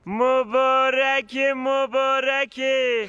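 very slow stretched mabarak; hammed-up slow blessing; drawn-out congratulations. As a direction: stretch every syllable. Congratulating slowly.

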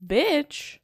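high, loud burst of shock; sudden loud, high outburst; startled exclamation. Real, not acted. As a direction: blurt it out, pitch jumping up. Startled exclamation at game text.

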